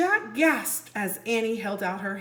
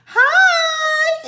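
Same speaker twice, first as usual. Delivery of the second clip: very high, loud call; 14 dB louder than usual. Acted. Annie calling again to the pterodon, loud and high. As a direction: sing out to the creature. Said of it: "Annie called again to the creature".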